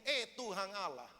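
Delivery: retold angry challenge, building to loud orders; angry complaint. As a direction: act out an indignant farmer ordering God about. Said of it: "dia marah, dia marah, tahu nggak? Dia marah berkaca pinggang".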